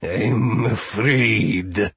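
very slow, drawn-out offended menace; piqued. A narrator's reading. Steward takes offence at Grinda, slow pique. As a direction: stretch each word in wounded dignity. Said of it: "in a fit of intense pique".

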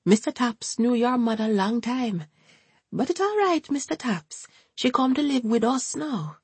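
put-on high, sing-song elderly woman's voice; older woman reassuring gently; old Jamaican woman. A narrator's reading. Hortense voiced by narrator.

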